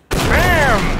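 shouted sound effect; loud bam. Acted. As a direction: yell it like an explosion. Firing the tank.

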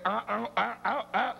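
mock baby babbling; imitating toddler babble. Father imitating his baby daughter, mock babble. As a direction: babble like a two-year-old. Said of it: "lo único que sabe hacer es".

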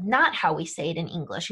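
ordinary talk, nothing marked

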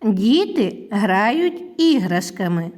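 slow model sentence with gaps between words; deliberate teacher's dictation pace. Shown as a demonstration. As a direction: set Діти, грають, іграшками apart, each clearly spoken. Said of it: "склади речення".

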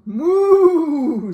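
big drawn-out cow noise; cow moo. Acted. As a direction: moo out loud like a storybook cow. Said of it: "said Cow".

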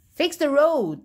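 high, loud protest shout; angry shout. A narrator's reading. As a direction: yell it at the mayor. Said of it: "they shouted".

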